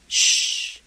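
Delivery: shush. Acted. Voicing the shushing neighbour.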